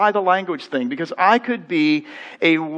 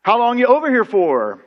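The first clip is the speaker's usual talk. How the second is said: high, louder surprised "oh, you're a Yank"; surprised British clerk; 6 semitones higher, 4 dB louder, slower than usual. Retold or imitated. Clerk spots an American, surprised and higher. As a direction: clerk lights up hearing an American. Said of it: "and then they say".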